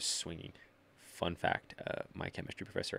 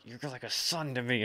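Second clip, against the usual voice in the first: higher, lightly voiced fatherly teacher; 5 semitones higher than usual. Teacher shaking a student, mock fatherly. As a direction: play a doting old teacher, affectionate. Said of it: "it's like".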